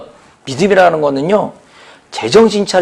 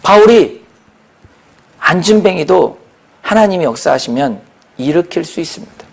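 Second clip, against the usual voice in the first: ordinary delivery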